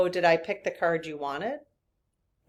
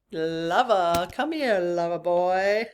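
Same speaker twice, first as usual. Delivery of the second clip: slow, drawn-out come-hither call; Dirty Dancing quote; 3 dB louder, slower than usual. Retold or imitated. Quoting Dirty Dancing to her viewers, a slow playful come-hither call. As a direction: coo it like a lightly voiced movie seduction. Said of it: "Anyone know what that's from?".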